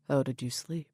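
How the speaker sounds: gentle, unsure question; hesitant. A narrator's reading. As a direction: ask it carefully, a bit tentative. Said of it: "he asks hesitantly".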